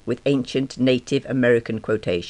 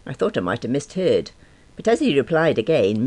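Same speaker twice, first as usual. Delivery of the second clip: ordinary delivery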